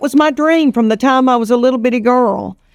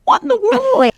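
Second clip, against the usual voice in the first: high-pitched astonished What in the world; amazed high exclaim; 13 semitones higher than usual. Retold or imitated. Her daily reaction to her luck, high and amazed. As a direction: squeal What in the world with disbelief. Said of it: "every day I go".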